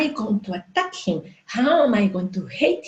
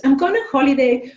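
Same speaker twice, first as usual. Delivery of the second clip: higher, louder, breezy friend voice; a friend breezily announcing she's off on holiday; 6 semitones higher, 5 dB louder than usual. Retold or imitated. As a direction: toss it off like a carefree friend. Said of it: "And she says".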